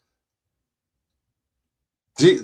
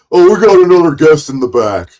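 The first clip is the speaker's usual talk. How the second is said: loud, quick mocking impression of a host; Jack; 2 semitones higher, 6 dB louder, faster than usual. Mocking how the host announced him backstage, loud impression. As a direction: mimic the host announcing a guest, louder and faster. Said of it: "then he goes".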